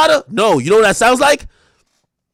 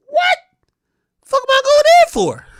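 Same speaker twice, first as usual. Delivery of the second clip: incredulous. Real, not acted. Incredulous at the idea.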